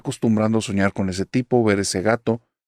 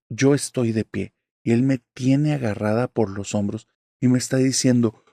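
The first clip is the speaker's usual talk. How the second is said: ordinary delivery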